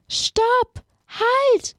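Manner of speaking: high shouted stop in a put-on witch voice; angry shout. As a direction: yell at them to stop. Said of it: "Ruft die Hexe mit bösem Gesicht".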